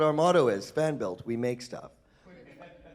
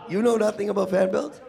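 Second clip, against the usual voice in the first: higher and louder, a mock-astonished question; mock surprise; 9 semitones higher, 8 dB louder than usual. Panelist teasing a silent audience, mock astonished. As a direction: feign disbelief at the crowd.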